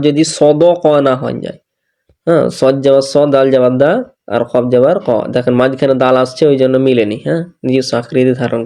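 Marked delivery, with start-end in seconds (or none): none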